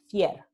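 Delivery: "fier" con r simple al final en lugar de l; Andalusian fier. Shown as a demonstration. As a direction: decir "fier" terminando en r suave. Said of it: "se pronuncia fier". ("fier" pronunciado a la andaluza)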